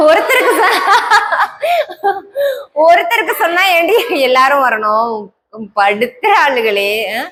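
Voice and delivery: usual speaking voice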